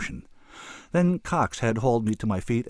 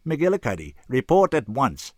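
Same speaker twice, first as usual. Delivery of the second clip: louder, higher order to a subordinate; commanding summons; 6 semitones higher, 6 dB louder than usual. Acted. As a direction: summon an underling with authority. Boss summons subordinate by intercom.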